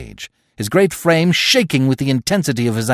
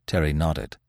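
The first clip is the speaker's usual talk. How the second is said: plain reading voice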